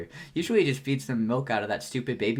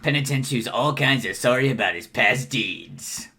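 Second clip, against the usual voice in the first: Gamzee; 5 dB louder than usual. Acted. Clown preacher presents a sorry penitent, loud sermon voice. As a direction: proclaim the sinner's regret to the crowd. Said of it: "I fucking love doing Gamzee's voice".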